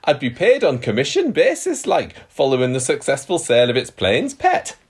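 amateur Geordie accent, slightly laughing. Shown as a demonstration. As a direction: read the quote in a hammed-up Geordie accent. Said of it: "He didn't say like or pet".

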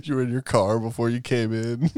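ordinary talk

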